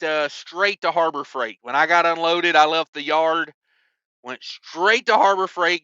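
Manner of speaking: ordinary talk, nothing marked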